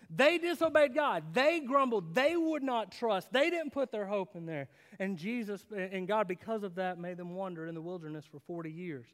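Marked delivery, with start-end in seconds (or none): 0.1-4.7 louder, higher fervent preaching; forceful preaching. Acted. Pastor driving home Israel's disobedience to his church, fervent preaching. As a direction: preach it hard to the crowd.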